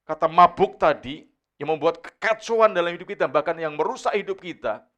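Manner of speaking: loud, high forceful sermon; full-force preacher exhortation; preaching. Acted. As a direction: proclaim it to the whole church.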